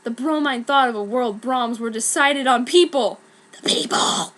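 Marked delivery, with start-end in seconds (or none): 3.5-4.3 scheming broom. Acted. The broom plotting against Harry, whispered. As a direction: plot it under your breath. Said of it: "Braum said".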